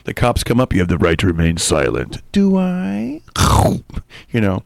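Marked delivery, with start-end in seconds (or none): 0.7-2.2 low, official cop voice; a police officer voiced low and official. Cop arrests him. 2.3-3.2 squeaky high question; answering the cop in a high squeak. Pretend. Replying to cop.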